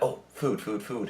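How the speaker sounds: a hint of a hungry pet turtle asking for food; eager turtle. Pet turtle at the glass, lightly voiced eager begging. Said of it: "be like".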